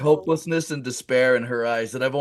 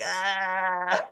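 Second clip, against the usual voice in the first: high, held "ah" noise; mock yelp; 6 semitones higher than usual. Teller looks back at his buddies, high mock yelp. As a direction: imitate a silly drawn-out yelp.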